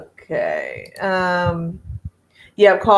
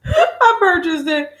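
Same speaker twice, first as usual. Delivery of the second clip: laughing; 12 semitones higher, slower than usual. Real, not acted. Laughing confession of purchase.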